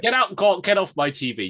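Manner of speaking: loud, high comic outrage; hammed-up indignant yell; mock yelling. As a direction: shout as if chasing someone off. Pretend yelling at Jared on TV.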